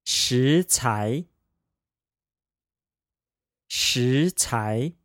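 食材 said shí cái, two rising tones; 食材 with a second tone on both syllables; practicing tones. Shown as a demonstration. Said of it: "second tone pair".

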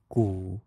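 gu low and dipping; third tone. Shown as a demonstration. Teacher sings gu for students to repeat, low third tone. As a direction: drop gu low and soft, let it dip. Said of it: "sing the four tones of this combination".

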